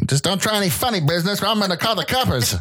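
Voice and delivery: 1920s gangster voice; old-time gangster. Pretend. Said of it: "an old-time radio show".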